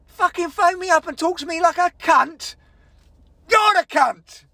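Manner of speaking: high-pitched, furious outburst; real shouted fury; ten-out-of-ten anger. Real, not acted. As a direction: let the rant peak in a shout. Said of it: "ten out of ten".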